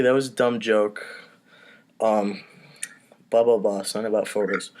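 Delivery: normal talking voice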